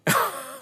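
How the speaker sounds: a short high delighted laugh. Real, not acted. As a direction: laugh with pleasure at your own plot. [name] laughs at his story.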